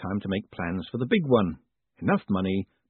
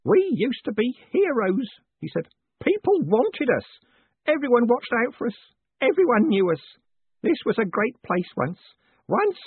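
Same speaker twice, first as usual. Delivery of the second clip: put-on tearful old Grote; 12 semitones higher, 4 dB louder than usual. A narrator's reading. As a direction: mourn the heroes you once were. Grote mourns past glory.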